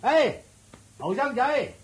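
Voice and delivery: high-pitched call to someone away. Acted. Servant calls the young man over, calling out.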